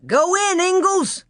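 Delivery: a high urgent yell to a friend in a scuffle. Acted. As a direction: shout him through the door. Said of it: "he yelled".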